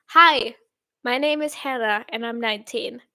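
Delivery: introduction in her everyday English accent; English sample sentence. Shown as a demonstration. Streamer comparing accents for viewers, English sample sentence. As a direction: say it in English, neatly spaced for comparison. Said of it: "speak a sentence in English".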